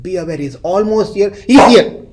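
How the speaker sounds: starts urgent and ends in a loud high cry; building to a shout. Retold or imitated. Man in the story crying out that the thief is here, rising to a shout. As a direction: build the warning up until you shout the last words.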